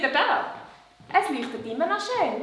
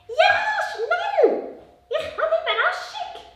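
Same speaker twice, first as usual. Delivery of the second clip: high, louder puppet urging; excited puppet voice; 5 dB louder, slower than usual. Acted. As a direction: hurry him in a high puppet voice. Puppet Lola shouts.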